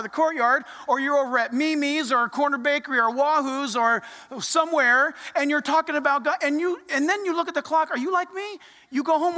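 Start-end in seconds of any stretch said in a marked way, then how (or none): none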